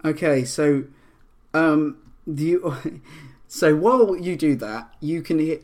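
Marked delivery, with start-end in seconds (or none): none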